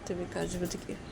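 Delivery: plain reading voice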